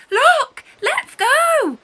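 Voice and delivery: very high loud excited yell; rally your friend at full voice; shout. A narrator's reading. Benz shouting to Wonderful to run to the waves, loud yell. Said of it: "shouts Ben's".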